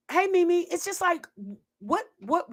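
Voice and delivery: normal talking voice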